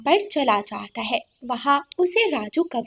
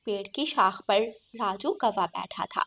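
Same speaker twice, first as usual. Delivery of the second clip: ordinary delivery, nothing marked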